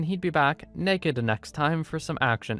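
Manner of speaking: normal talking voice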